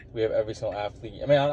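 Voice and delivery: normal talking voice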